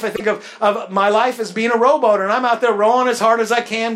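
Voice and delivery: lively pulpit delivery; preaching. Acted. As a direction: preach it out to the congregation. Preacher building to a story.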